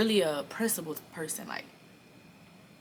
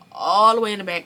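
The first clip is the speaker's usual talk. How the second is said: ALL stretched long and loud; 4 semitones higher, 14 dB louder than usual. Real, not acted. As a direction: blow up "All" to show how far away it was. Repeats phrase, stretched for distance.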